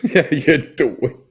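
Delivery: loud, stretched imitation Jersey greeting; Jersey accent. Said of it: "that's a Jersey thing".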